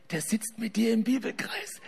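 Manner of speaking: hushed, unvoiced aside; whispered aside. Preacher to the audience about Fritz, whispering. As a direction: lean in and whisper it to the crowd.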